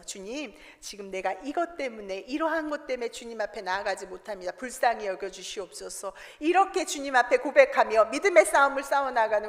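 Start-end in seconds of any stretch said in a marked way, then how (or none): 0.0-6.1 soft, lower, humble plea; quiet prayer. Retold or imitated. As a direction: pray it softly to the Lord. (조용히 간구하는 기도)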